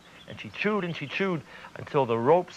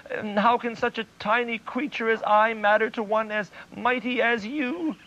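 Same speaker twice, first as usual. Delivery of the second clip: much higher, pleading squeaky voice; high frightened mouse; 7 semitones higher than usual. Acted. Tiny mouse begging the mighty lion for mercy. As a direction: beg like a tiny terrified mouse. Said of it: "the little mouse was terrified".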